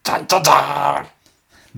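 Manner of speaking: Nyanchu voice. Pretend. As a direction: squeeze the throat and growl it. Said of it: "やってみ？ そのままガーって声で".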